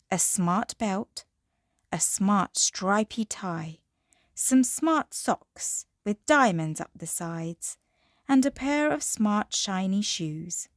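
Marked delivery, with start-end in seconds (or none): none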